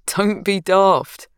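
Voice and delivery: slow, fond teasing; affectionate. Acted. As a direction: gently chide someone you love. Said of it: "said affectionately".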